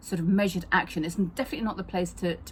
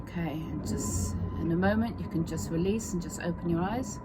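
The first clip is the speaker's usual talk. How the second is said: softer, unhurried guided meditation voice; soothing massage murmur; 6 dB quieter than usual. Acted. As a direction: ease the listener into calm with long pauses. Leads a guided relaxation exercise.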